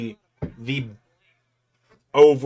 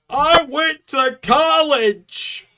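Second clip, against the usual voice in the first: high, slow shrieking cartoon cry; Plankton voice; 8 dB louder, much slower than usual. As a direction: wail it like a frustrated cartoon villain. Plankton-style outburst, acted response.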